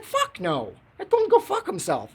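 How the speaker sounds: high, airy put-on voice for the wife; a hammed-up high female voice; mock girlfriend voice. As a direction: jump way up in pitch to play her. Shelby's retold reply, acted.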